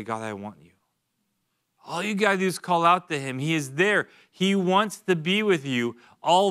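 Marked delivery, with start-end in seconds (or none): 0.0-0.7 soft, hushed plea to God; quiet heartfelt prayer. Pretend. A believer quietly calling out to God in a softly pleading voice. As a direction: murmur it like a whispered prayer.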